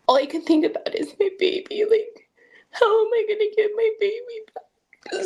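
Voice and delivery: real tears, pitch cracking upward; crying. Real, not acted. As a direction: voice breaking high into sobs. Mother cries retelling losing her baby.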